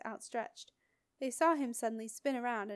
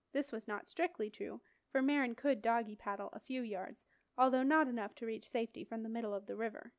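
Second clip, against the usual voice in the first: usual speaking voice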